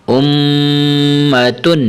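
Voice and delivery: slow recitation, the m of امتن hummed long; held ghunnah. Shown as a demonstration. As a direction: hold the doubled meem in امتن, then a short tun. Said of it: "آپ نے زیادہ لمبا نہیں کرنا".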